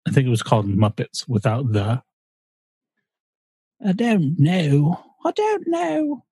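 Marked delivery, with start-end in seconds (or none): 3.8-5.0 drawn-out goofy voice; slow, silly character voice. Pretend. Silly voice in Muppet talk. 5.2-6.2 very high, slow squeaky voice. Pretend. Silly voice in Muppet talk.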